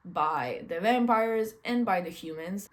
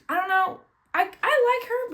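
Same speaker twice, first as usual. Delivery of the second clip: exasperated high burst; 11 semitones higher, 8 dB louder than usual. Real, not acted. Frustrated outburst about character.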